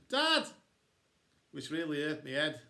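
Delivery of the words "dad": loud, high call for Dad; shout. A narrator's reading. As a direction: shout out for a parent, high and sudden. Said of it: "So I shouted".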